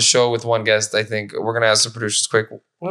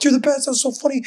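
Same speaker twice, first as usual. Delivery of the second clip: fans gushing; 12 semitones higher than usual. Retold or imitated. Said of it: "so many people a few seconds ago were all like".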